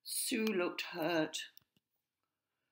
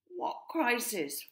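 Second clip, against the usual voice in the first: demanding; 7 semitones higher, 3 dB louder, slower than usual. A narrator's reading. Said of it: "Fire demanded".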